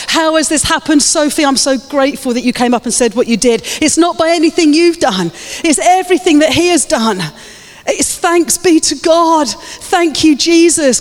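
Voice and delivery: high-pitched, fervent proclamation; rousing sermon delivery; impassioned preaching. Real, not acted. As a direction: preach it to the whole room, lifted high. Preaches at full force.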